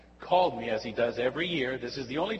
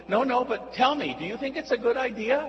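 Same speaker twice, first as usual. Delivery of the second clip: high, quick, louder interrogation; grilling friend; 10 semitones higher, 4 dB louder, faster than usual. Retold or imitated. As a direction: fire the question at him. Friend interrogates, high fast loud.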